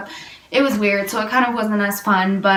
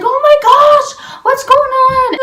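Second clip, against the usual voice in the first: high, loud panicked question; alarmed cry; 7 dB louder than usual. Retold or imitated. Friends seeing her running in tears, panicked high cry. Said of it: "everybody was like".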